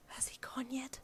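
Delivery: soft, high timid whisper. A narrator's reading. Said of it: "a timid voice replied".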